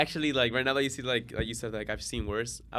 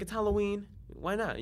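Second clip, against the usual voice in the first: carefree self-justifying; 10 semitones higher than usual. Retold or imitated. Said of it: "it was like".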